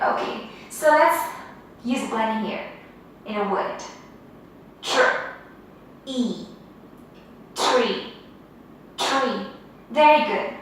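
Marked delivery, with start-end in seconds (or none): 4.8-9.5 syllable-by-syllable drill; slow sound-out. Shown as a demonstration. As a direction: split tr from ee, then blend tree. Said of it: "Say with me".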